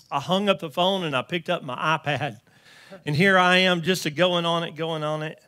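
building preaching. Acted. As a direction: lift the story into preaching. Preaching rises again.

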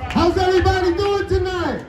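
crowd hype shout. Acted. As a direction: shout it to get the crowd going. Host repeats greeting to rouse crowd.

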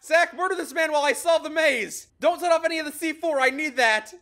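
loud, high, rushed orders; urgent shouting. Real, not acted. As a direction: yell orders over the chaos. Urgent orders during attack.

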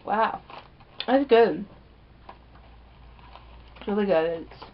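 muffled eating-time speech; talking while chewing. Real, not acted. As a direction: mouth full of food. Seen 0: Lips pressed, cheeks working, chewing between words; bag in hand.

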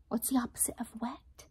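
quick whispered question. Shown as a demonstration. Teacher whispers the quiz to children, quickly.

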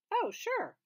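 startled into answering; high flustered yes. A narrator's reading. Peter flustered.

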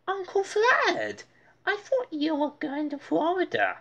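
put-on high boy's voice; a touch surprised; surprised Arthur. A narrator's reading. Arthur greeting Uncle Fred at night. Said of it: "said Arthur".

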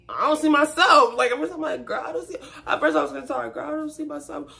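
put-on stunned "girl, I don't see myself"; high disbelief. High excited retelling of disbelief.

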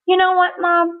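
lightly voiced boy asking his mom; young boy. A narrator's reading. Sammy tucked in by mom, in a boyish voice.

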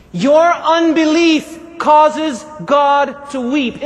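very slow, weighty declaration; slow, forceful preaching; emphatic. Real, not acted. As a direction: drive home each word separately. Preacher drives home his key point.

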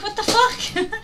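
mock incredulous, pitched high; high-pitched indignant quote; retold shock. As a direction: act out a taken-aback reply. Retelling her shocked reply, pitch jumps.

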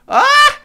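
a very high, loud scream of alarm; panicked scream. Real, not acted. Gamer in a spinning elevator, screaming in panic. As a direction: scream as if you are about to fall.

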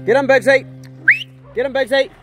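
high, loud cheering on the dog; excited urging. Real, not acted. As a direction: egg him on loudly. Urging dog as truck arrives.

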